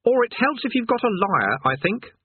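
a know-it-all lad, put off; young Eric. Acted.

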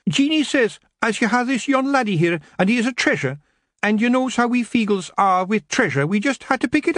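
high Scots pixie, sheepish; a wee thief owning up; explaining Rob. Acted.

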